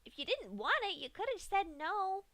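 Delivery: high pony voice, mildly pouting; a little hurt, childlike; sulky Pinkie. Acted. Pinkie pouts at Dash for spitting it out, high voice. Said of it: "Pinkie frowned".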